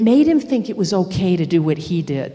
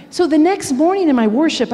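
ordinary talk, nothing marked

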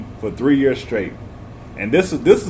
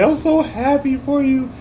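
mock crying teacher; 12 semitones higher than usual. Teacher congratulating him on a C plus, high and slow. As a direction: gush like a tearful teacher. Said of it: "she was crying like".

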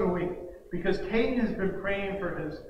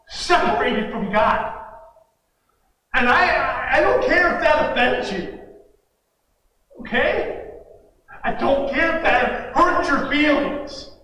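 impassioned gospel preaching; 2 semitones higher, 4 dB louder than usual. Real, not acted. Preacher confronting the congregation with the gospel, impassioned. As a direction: press each point home with urgent conviction.

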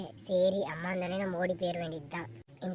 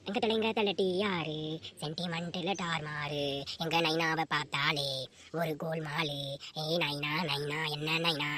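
lower-voiced cheeky young girl; playful daughter; 5 semitones lower than usual. Daughter teasing her father about marriage, playful. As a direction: tease her father lightly.

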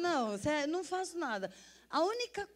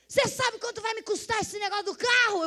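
much higher, louder retold dad; scolding father; 11 semitones higher, 7 dB louder than usual. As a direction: voice the dad demanding the cost. Father scolding about the car.

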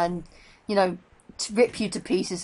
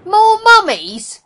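high, loud, drawn-out cry; alarmed; 16 dB louder, much slower than usual. Real, not acted. Alarmed at game enemies.

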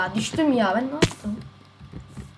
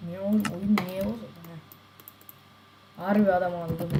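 normal talking voice

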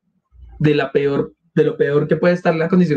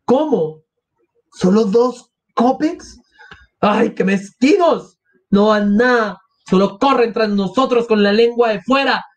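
outraged mother; 5 semitones higher, slower than usual. Acted. Mother outraged at the few coins.

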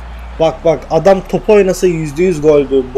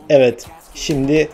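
normal talking voice

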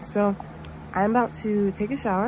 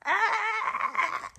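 giddy laugh; 13 semitones higher than usual. Real, not acted. Back from dentist, laughing.

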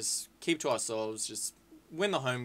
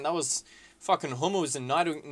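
usual speaking voice

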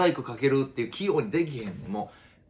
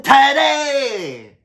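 long, high, drawn-out yell of "tada"; catchphrase shout; 12 semitones higher, 10 dB louder, much slower than usual. Acted. Comedian yelling his catchphrase at the audience, long and high. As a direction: belt it out, stretched and high.

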